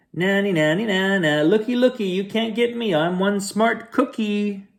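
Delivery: high singsong taunting chant; cookie taunt. Acted. Cookie taunts the chasing bad boys in high singsong. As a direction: tease them like a cheeky runaway.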